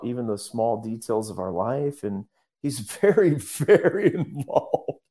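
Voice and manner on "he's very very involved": laughing. Real, not acted. Laughs while stressing very.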